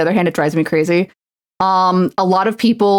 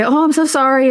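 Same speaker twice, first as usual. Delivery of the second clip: higher, put-on apologetic tone; mock apology; 5 semitones higher than usual. Tired worker giving the expected excuse, mock apology. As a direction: play up a fake sorry. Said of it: "people want you to say".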